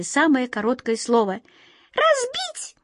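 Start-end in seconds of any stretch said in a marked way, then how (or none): 1.9-2.8 very high tiny mouse voice; high-pitched squeaky little creature; mouse squeak. A narrator's reading. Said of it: "закончила последняя мышь".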